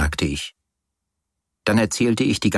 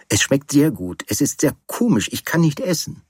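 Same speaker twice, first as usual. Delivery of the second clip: higher-pitched, pleading excuse; earnest protest; 6 semitones higher than usual. Acted. Man explaining to his companion why he cannot eat, protesting. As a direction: plead your case in a raised pitch. Excusing himself for not eating.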